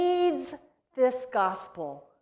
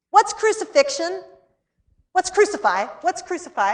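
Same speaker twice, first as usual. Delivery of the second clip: put-on higher, insistent child voice; pressing questions of a small boy; urgent child questions; 8 semitones higher, 5 dB louder than usual.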